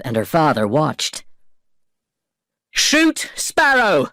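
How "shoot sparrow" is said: shouted order. Acted. Norrington ordering his men to shoot Jack, shouting. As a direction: yell the order across the harbor. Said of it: "shouted Norrington".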